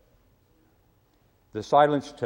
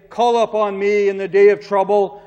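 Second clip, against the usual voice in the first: proclaimed promise; 6 semitones higher, 8 dB louder than usual. Acted. Preacher voicing God's promise.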